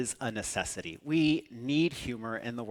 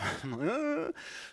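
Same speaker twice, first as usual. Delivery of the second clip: high laugh; 11 semitones higher, 3 dB quieter than usual. Real, not acted. Laughing at own story.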